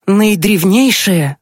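high, slow, drawn-out teasing reveal; mysterious. Acted. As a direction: stretch the word out high, with mystery. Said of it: "загадочно произнесла Маша". (загадочно, высоко и протяжно)

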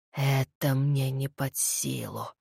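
low, slow voice of the dead; undead voice. Acted. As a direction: answer from beyond the grave. Dead head answers.

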